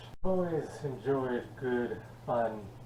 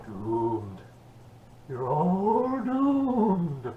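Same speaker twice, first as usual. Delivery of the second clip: very slow, rising eerie doom voice; slow ominous raspy drawl; 5 semitones higher, 5 dB louder, much slower than usual. Acted. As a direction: draw it out like a doom prophet. Ominous sign-off of the review.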